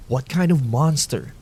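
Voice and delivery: plain reading voice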